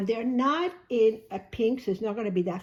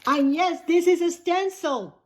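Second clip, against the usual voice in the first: emphatic correction; 8 semitones higher, 8 dB louder than usual. Real, not acted. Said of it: "Thank you, Trish, for bringing it to my attention".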